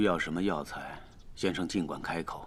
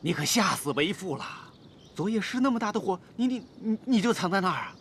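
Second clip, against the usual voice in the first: raised pitch, agitated worry; frightened father's fretting; 14 semitones higher, faster than usual. Acted. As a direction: fuss anxiously over a child who scared you.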